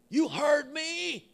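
eerie woman calling out, slow and high; demonic woman voice. Retold or imitated. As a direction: drag it out like a spooky stranger. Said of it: "this demonic woman, I hear a voice".

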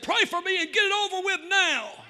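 high, loud yell of surrender; exasperated shout. Retold or imitated. Atheist jumps out and demands prayer, exasperated shout. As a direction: shout it out, fed up and giving in. Imitates atheist jumping out, giving in.

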